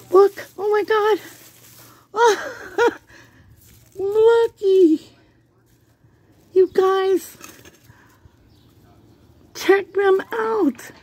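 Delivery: high-pitched delighted squeal; bursting with real delight; thrilled. Real, not acted. Thrilled by the potato harvest.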